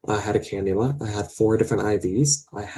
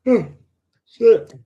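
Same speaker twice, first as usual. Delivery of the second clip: sudden loud curse; 13 semitones higher, 8 dB louder than usual. Real, not acted. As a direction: voice jumps high and loud. Emotional outburst mid-sentence.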